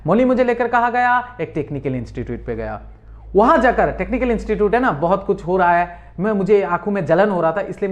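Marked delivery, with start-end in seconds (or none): 1.4-2.8 soft answer. Shown as a demonstration. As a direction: lower and softer model answer. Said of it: "जो जो colour red में है, उसमें ध्यान देना है".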